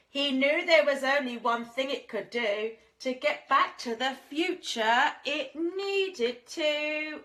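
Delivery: drawn-out teasing storyteller; slow down and make them wait; storyteller building to the punchline; suspenseful build. A narrator's reading.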